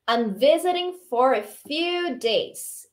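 very slow, careful English; slow model reading. Shown as a demonstration. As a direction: stretch each word for learners. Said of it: "model line for repetition".

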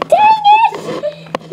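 sudden high yelp; frustrated outburst. Real, not acted. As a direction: blurt it out annoyed.

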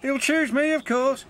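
high-pitched cocky boast; boastful. A narrator's reading. James putting himself forward for the royal train, boastfully. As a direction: brag in a high, sure voice. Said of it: "boasted James".

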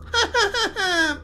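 high ghoulish cackle; grotesque laugh. Acted. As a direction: let out a grotesque, eerie laugh. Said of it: "Sonríe grotescamente".

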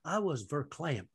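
put-on earnest, swooping televangelist storytelling in a Southern accent; Joel Osteen. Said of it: "That's a really good accent".

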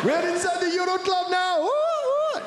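put-on very high, thrilled Greek accent with squeals; giddy Greek clubber who finally got in; high squealing Greek accent. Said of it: "the Greek accent".

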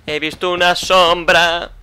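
slow, loud, drawn-out announcement; emphatic amazement. Real, not acted. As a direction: stretch each word in exaggerated astonishment. Excitedly repeats having seen a shadow. (asombro exagerado, lento y fuerte)

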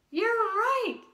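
big mock discovery for the kids; surprised gasp. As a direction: gasp then high, loud surprise. Discovers hat on head.

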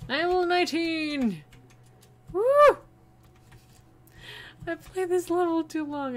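ordinary delivery

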